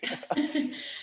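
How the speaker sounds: squeaky unvoiced giggle; high breathy laugh. Real, not acted. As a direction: laugh in amused disbelief. Amused laugh at guest.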